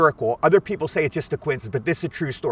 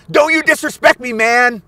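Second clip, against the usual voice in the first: much higher, louder menacing yell at a stranger; threatening thug; 8 semitones higher, 7 dB louder than usual. Retold or imitated. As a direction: bark it out like a street thug squaring up. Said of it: "Then I hear from the side".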